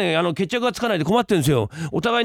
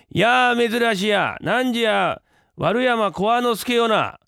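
childish play-acting; 7 semitones higher, much slower than usual. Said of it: "お前、子供か".

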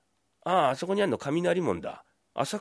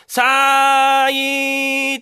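long drawn-out loud call; calling from far behind; 11 semitones higher, 9 dB louder, much slower than usual. Acted. Hunter calling to the heron from far behind, long loud drawn-out cry. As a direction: call out to a heron far away, stretching the vowel. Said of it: "って声かけるんですよ".

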